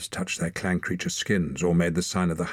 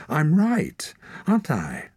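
higher, louder; insistent; 10 semitones higher, 4 dB louder than usual. A narrator's reading. Torak insisting to the leader, loud. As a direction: press the point. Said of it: "Torak insisted".

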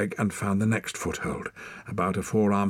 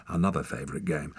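ordinary delivery, nothing marked

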